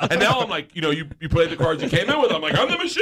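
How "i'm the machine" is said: high, loud triumphant boast; belted, high-pitched self-introduction; boastful yell. Acted. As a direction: crow it like a party catchphrase. Bragging to the gangsters, acted out.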